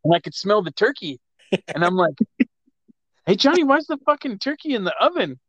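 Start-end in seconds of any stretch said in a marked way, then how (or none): 3.2-5.4 higher baffled call. Retold or imitated. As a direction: ask why the turkey is already in. Retold confused outburst.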